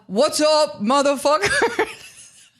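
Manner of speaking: hammed-up Slovenian accent impression. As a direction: stretch it out like a swaggering Slovenian star. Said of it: "Slovenian accent".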